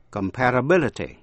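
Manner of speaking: comparability accented on "bil"; stress on B syllable. Shown as a demonstration. Teacher sums up the word family, comparability stressed on the B syllable. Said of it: "nhấn vào vần B".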